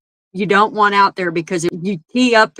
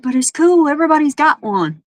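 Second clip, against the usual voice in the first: put-on pleading kid voice; 6 semitones higher than usual. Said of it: "I said".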